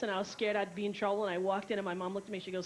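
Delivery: ordinary talk, nothing marked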